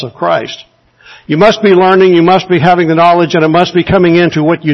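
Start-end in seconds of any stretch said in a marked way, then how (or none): none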